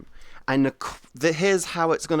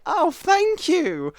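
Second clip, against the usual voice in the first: much higher, slow, sweet thank-you; sugary thanks; 13 semitones higher, 4 dB louder, much slower than usual. Retold or imitated. A streamer thanking a new subscriber, high and sugary. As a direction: gush over it like a sweet hostess. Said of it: "I'm like".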